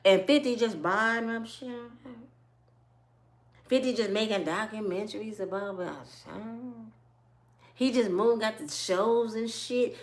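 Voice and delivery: normal talking voice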